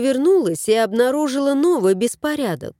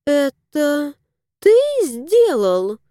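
cautious question; 5 semitones higher, slower than usual. A narrator's reading. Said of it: "осторожно спросила Алевтина".

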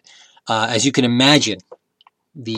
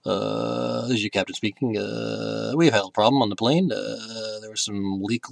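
low-volume airline captain announcement; imitated captain's drone with drawn-out uhs; pilot voice; 3 semitones lower, 8 dB quieter, slower than usual. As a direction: mumble softly like a pilot on the intercom. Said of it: "him in the pilot voice".

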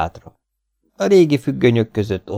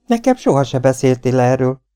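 raised, louder, startled wife's voice; frightened; 6 semitones higher, 5 dB louder than usual. A narrator's reading. Wife, alarmed, confronting Lee: frightened. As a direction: blurt it out in alarm. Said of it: "mondta az asszony riadtan".

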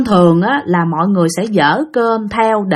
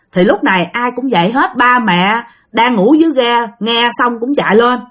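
ordinary delivery

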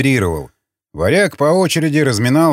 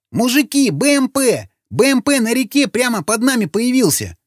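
much higher, frantic shouting character voice; alarmed yell; 11 semitones higher than usual. A narrator's reading. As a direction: yell the alarm to the men at the top of the voice. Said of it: "закричал Сквернослов".

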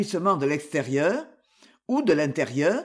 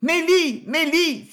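appel fort et bien plus aigu, deux fois; crier le nom; 10 semitones higher, 4 dB louder, slower than usual. Acted. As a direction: crier vers la servante à travers la boutique. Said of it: "s'écria-t-elle". (la marchande appelle la bonne : cri aigu et fort)

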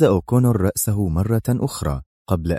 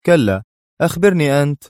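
mocking retort, higher and louder; taunting; 7 semitones higher, 4 dB louder than usual. A narrator's reading. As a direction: toss it back with a taunt. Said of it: "أجابه أدهم في تهكم". (رد متهكم بصوت أعلى)

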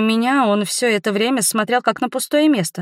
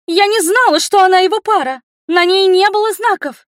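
high, loud shout of protest; outraged cry; 11 semitones higher, 5 dB louder than usual. A narrator's reading. Accused princess protesting to the rector, crying out. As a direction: cry out in your own defence, pitch way up. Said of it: "выкрикнула Фурия".

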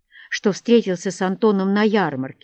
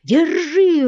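high, drawn-out rallying yells; urgent tavern hue and cry; crowd shouting; 8 semitones higher, 6 dB louder, slower than usual. A narrator's reading. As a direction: call out to seize them, high and loud. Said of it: "раздалось со всех сторон в кабаке".

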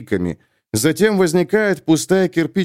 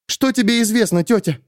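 put-on higher, urgent young heroine; young woman, urgent; 9 semitones higher than usual. A narrator's reading. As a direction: press it, tense and scared. Sulin voiced higher.

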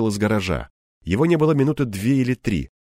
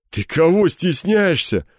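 slow, higher teasing question; amused sneer; 8 semitones higher, 4 dB louder, slower than usual. A narrator's reading. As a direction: ask with a smirk, taking your time. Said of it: "усмехнулся Кирпич". (с усмешкой, врастяжку)

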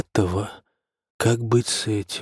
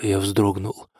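ordinary delivery, nothing marked

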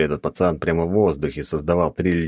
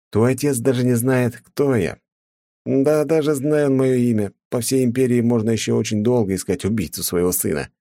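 с усмешкой; 7 semitones higher than usual. A narrator's reading. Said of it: "усмехнулся я".

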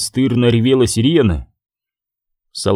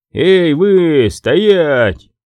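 shouted order; 6 semitones higher, 4 dB louder, much slower than usual. A narrator's reading. Soldier halts children from behind.

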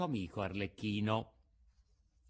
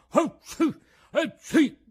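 two big high sneezes; loud sneezes; 11 dB louder, slower than usual. A narrator's reading. Mangiafoco sneezes from emotion, two loud high sneezes. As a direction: sneeze it out explosively. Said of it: "E fece altri due starnuti".

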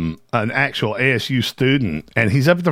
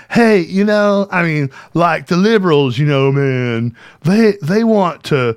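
higher, louder dopey fan voice; mock softball question; 5 semitones higher, 3 dB louder than usual. Streamer mocks the tame questions TPUSA wants, dopey. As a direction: play a clueless eager conservative student. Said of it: "than shit like".

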